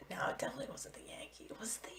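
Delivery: near-whispered self-questioning; thinking aloud. Real, not acted. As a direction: mumble it to yourself, doubting your memory. Unsure, checking recall to themselves.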